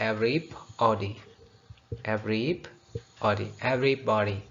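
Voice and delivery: Everybody said slowly and carefully, syllable by syllable; slow drilled pronunciation. Shown as a demonstration. Teacher modelling everybody slowly for students. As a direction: drag out Everybody for learners. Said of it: "कैसे बोलना है?".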